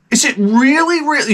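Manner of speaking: mock disbelief at car guys; exasperated disbelief. Real, not acted.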